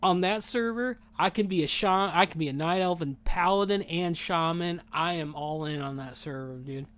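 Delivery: normal talking voice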